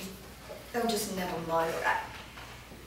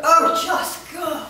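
exasperated; 8 semitones higher, 6 dB louder, much slower than usual. Acted. Master dismisses fawning servant.

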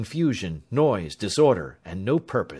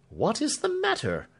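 higher, startled question stressing the; alarmed exclamation; 13 semitones higher than usual. A narrator's reading. Alfred asks Clemency what is wrong, alarmed. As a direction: demand an answer in alarm. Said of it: "he exclaimed".

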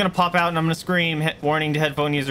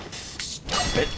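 whispered; 5 dB quieter than usual. Real, not acted. Whispered under breath.

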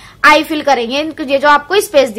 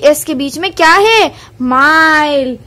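normal talking voice

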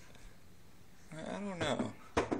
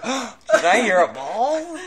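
high, loud startled question; mock surprise; 8 semitones higher, 9 dB louder than usual. Riffing on a surprised-looking dude, exaggerated surprise. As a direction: act startled, pitch leaping up. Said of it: "looking all surprised".

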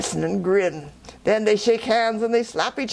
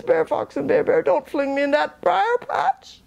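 pleading rabbit; 7 semitones higher than usual. Acted.

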